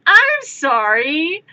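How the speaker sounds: hammed-up indignant girlfriend; mock outrage from the doorway; outraged disbelief. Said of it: "and goes".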